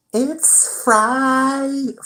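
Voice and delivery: very high, sing-song manager imitation; mimicking the manager. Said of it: "he'd be like".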